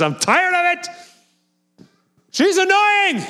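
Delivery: high, whiny fed-up judge; exasperated judge. Retold or imitated. Judge complaining about the persistent widow, high and whiny. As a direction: play the judge who's had enough of her.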